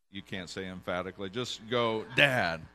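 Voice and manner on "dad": DAD said loud and forceful; emphatic. Shown as a demonstration. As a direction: punch the word Dad with emphasis. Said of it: "say Dad emphatically".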